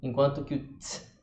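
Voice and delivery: normal talking voice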